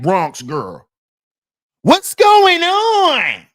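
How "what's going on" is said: much higher, slow, loud hammed-up diva voice; hungry wife mocked yelling for her food; loud restaurant demand. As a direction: bellow it across the restaurant like an impatient diva. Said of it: "loud in the restaurant".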